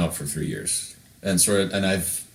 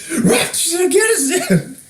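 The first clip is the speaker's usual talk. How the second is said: very high, loud, slow yell with a voice crack; high cracking shout; 6 dB louder than usual. Retold or imitated. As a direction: shout it like a crazed soldier. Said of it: "Tim just, like, shouts".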